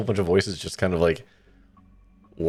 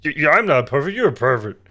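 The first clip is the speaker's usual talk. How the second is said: dumb guy voice; 5 semitones higher, 4 dB louder than usual. Acted. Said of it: "my go-to kinda dumb guy voice".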